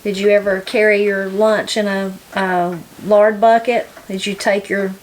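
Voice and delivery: ordinary talk, nothing marked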